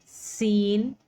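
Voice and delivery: सीन hissed with teeth together; Arabic seen; clear seen. Shown as a demonstration. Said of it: "दोनों दांतों को मिलाते हुए".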